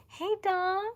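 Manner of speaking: high, loud mock challenge; playful acted teasing. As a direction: tease a latecomer in an excited high voice. Acts out teasing the chat's latecomer.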